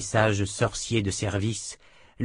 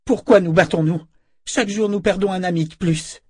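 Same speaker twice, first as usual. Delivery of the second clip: higher and louder, crying out through sobs; anguished sobbing cry; 10 semitones higher, 5 dB louder than usual. A narrator's reading. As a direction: wail it in despair. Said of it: "cria-t-elle entre deux sanglots".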